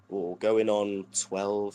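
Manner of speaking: normal talking voice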